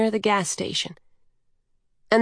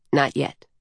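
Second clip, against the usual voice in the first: low, brief refusal; offhand Coyote; 5 semitones lower than usual. A narrator's reading. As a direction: put the child off in a lower voice. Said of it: "said Coyote".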